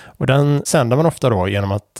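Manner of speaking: ordinary talk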